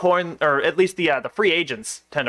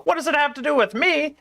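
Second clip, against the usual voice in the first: much higher-pitched indignant echo; incredulous echo; 11 semitones higher than usual. Acted. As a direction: throw the question back, pitched way up. Editor throws the question back.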